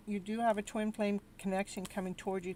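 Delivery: ordinary talk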